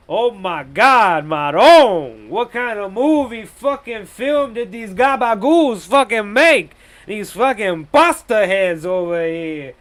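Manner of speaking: loud, drawn-out New York wiseguy rant; exasperated Italian-American New York accent, stretched vowels; hammed-up New York mob boss outrage; mock New York mobster accent. Mock Sopranos mobster rant at film.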